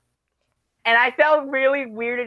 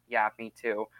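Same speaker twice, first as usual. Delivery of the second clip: low, soft retold agreement; quietly imitated commiseration; subdued reply; 9 semitones lower, 7 dB quieter than usual. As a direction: murmur it like a downcast friend. Voices friend's quiet sad reply.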